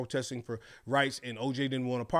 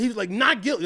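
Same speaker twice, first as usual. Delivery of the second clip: retold call; 8 semitones higher, 5 dB louder than usual.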